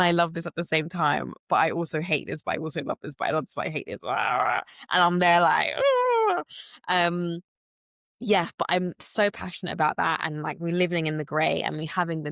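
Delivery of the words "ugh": a high, loud, drawn-out groan of frustration; exasperated groan. Retold or imitated. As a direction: let out a fed-up "Ugh" way up high. Overwhelmed by conflicted feelings.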